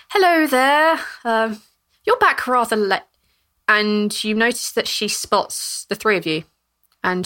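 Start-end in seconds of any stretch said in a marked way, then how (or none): 0.1-3.0 put-on high, lightly raised old lady voice; kindly old lady, pitched up; old woman. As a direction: greet them as a nosy old neighbour.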